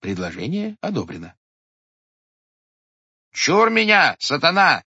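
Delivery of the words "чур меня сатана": high, loud frightened cry; warding off Satan. A narrator's reading. Clerk at the keyhole crying out at the devil, frightened. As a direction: shout the charm against the devil. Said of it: "продолжал писарь".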